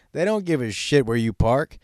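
ordinary delivery

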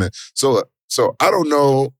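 ordinary talk, nothing marked

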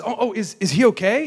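high, halting question of concern; mock worried. Sister asking after the homeless man, mock worried. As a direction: imitate her anxious fretting.

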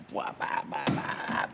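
whispered pa pa punching noises; unvoiced punch sounds. Storyteller miming punches with whispered pa pa sounds. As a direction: act out the blows with breathy pops.

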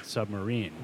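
ordinary talk

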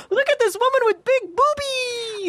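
gleeful dad imitation. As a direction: squeal it like an amused dad. Imitating dad showing her a photo.

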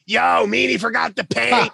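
high mocking call-out of a friend; mock loud announcement. Sandman telling the whole crew he forgot the paint, high and mocking. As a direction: sing it out so everyone hears. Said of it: "Douched me right off".

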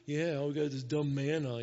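low, grumbling mock complaint; whining complaint. People grumbling around Moses about food, mock whining. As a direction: imitate a grumbling crowd, lightly whiny. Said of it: "all this whining going on and complaining".

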